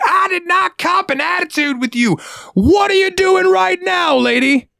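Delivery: high, slow falsetto retort; mock customer voice. Cashier mimics an offended customer in a slow high falsetto. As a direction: screech it like an offended lady.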